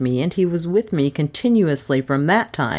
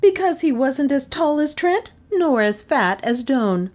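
high-pitched, pointed explanation; high, stubborn reasoning; indignant woman; 11 semitones higher than usual. A narrator's reading. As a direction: argue back in a high female character voice.

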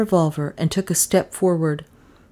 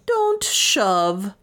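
higher, haughty protest; dignified rebuke, pitched up; greatest dignity; 4 semitones higher than usual. A narrator's reading. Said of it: "in tones of the greatest dignity".

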